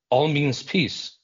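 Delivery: usual speaking voice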